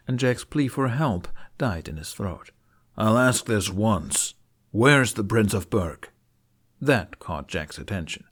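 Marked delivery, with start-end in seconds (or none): 3.0-6.1 menacing. A narrator's reading. Knight threatening with lance.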